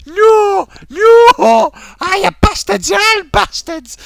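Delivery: high, loud hammed-up despair; mock meltdown, rising to wailed shouts; ranting streamer impression. Imitating streamer whose stream broke, mock furious wail. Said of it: "when you catch him on the live streams".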